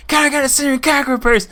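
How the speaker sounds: loud, high, fast blurted shout; high-pitched, hurried holler; shouting to be heard. Retold or imitated. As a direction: yell the request for the whole store. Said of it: "Speak up, I can't hear you".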